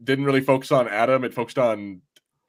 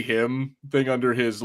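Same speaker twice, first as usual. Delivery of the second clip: ordinary delivery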